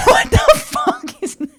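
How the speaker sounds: a shrill laughing what the; shocked high shriek. Retold or imitated. Retelling her reaction to Kim, a high shocked squeal.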